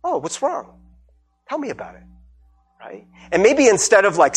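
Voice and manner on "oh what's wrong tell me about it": soft, higher-pitched concerned friend; gentle concern. Acted. Close friend checking on someone who isn't good, gentle concern. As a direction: invite them softly to open up.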